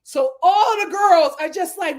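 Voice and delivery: usual speaking voice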